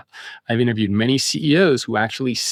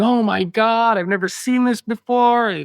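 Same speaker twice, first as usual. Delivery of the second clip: freaking out; 10 semitones higher, slower than usual. Retold or imitated. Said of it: "freaking out and crying".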